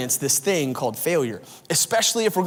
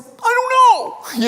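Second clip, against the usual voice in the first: very high, slow, drawn-out clueless voice; clueless Marcus; 4 dB louder, much slower than usual. Retold or imitated. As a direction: squeak it out like a helpless buddy.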